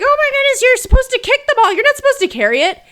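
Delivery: put-on high, loud, exasperated kid yell; mock yelling teammates. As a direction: shout it like frantic six-year-olds. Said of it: "yelling at me".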